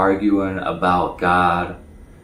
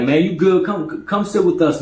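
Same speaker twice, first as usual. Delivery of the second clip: higher, easygoing welcoming voice; friendly invite; 8 semitones higher than usual. Retold or imitated. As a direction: wave him over kindly.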